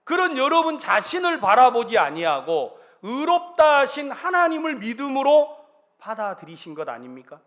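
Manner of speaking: loud, rising preacher's proclamation; fervent preaching. Real, not acted. Pastor preaches to the congregation, loud fervent proclamation. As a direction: proclaim God's word to the congregation.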